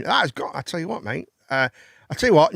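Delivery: normal talking voice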